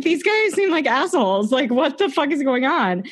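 high, disbelieving mock reaction; incredulous. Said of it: "I'm like".

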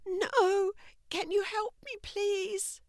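a small, pained plea for help; young woman in pain. A narrator's reading. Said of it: "Stacy shouted back".

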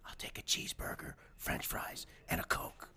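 whispered fast-food order, unvoiced. Customer whispers his order to the librarian, whispering. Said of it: "And he goes".

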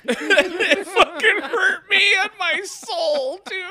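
high, wailing, laugh-cracked sobbing; hammed-up weepy rant; fake crying. S0 continues fake sob rant.

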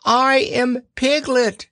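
very high, drawn-out insistence; loud protest. A narrator's reading. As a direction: declare who you are in a high Piglet voice. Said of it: "said Piglet loudly".

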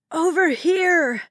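high, slow call; strained yell. A narrator's reading. Woman with smoke in her lungs, straining to yell. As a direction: try hard to be heard. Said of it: "Her voice was so hoarse".

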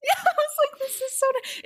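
squealing high through a laugh; high laughing mock. Guest retelling her reaction, high and laughing.

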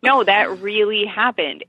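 ordinary talk

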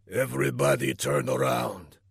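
low, controlled villain order; grim villain. A narrator's reading. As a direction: order them around in a low voice.

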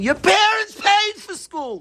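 high-pitched shout for emphasis; sudden scream. Shown as a demonstration. Teacher showing students a point of emphasis, sudden scream. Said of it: "If you scream your whole speech".

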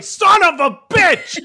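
high, loud, drawn-out yell; hammed-up outburst, stretching every word; mock cursing shout. Vet cursing the squirming dog, mock high shout.

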